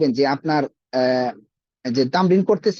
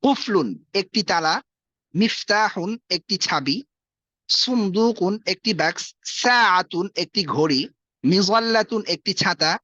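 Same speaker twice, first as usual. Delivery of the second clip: slow, deliberate vocabulary drill; unhurried teacher reading a word list; slow careful pronunciation; slower than usual. Shown as a demonstration. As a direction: say each Arabic word slowly for students to copy. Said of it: "উচ্চারণগুলো শুনবেন".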